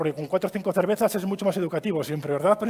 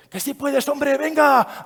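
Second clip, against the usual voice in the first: put-on high, loud child's voice, encouraging; rally the other kid like a teammate; cheering him on; 7 semitones higher, 6 dB louder, much slower than usual. Son encourages his opponent, high and loud. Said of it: "animándole".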